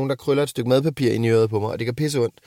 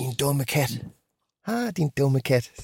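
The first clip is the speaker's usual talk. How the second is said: sweet pet talk; 4 dB quieter, slower than usual. Shown as a demonstration. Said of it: "Ligesom en hund".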